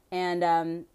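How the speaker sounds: ordinary delivery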